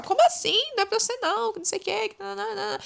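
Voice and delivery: high, indignant denial fading away; defensive friend denying the jab, high and indignant. Retold or imitated. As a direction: protest your innocence, then trail off. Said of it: "vai brigar com você e falar".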